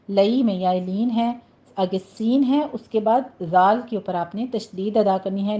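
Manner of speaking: ordinary talk, nothing marked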